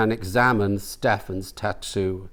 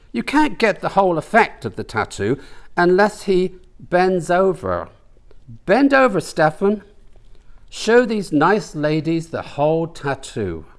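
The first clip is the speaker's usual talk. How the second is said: higher, playful teasing; mischievous host showing off; teasing man's voice; 7 semitones higher than usual. Acted. Brian shows the tattoo off to the ladies, playful.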